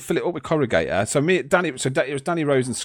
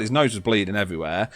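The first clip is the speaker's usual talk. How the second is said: normal talking voice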